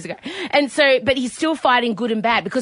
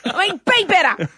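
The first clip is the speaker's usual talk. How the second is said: higher Aussie mum scolding; Aussie accent; 5 semitones higher than usual. Retold or imitated. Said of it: "Kylo Ren in an Aussie accent".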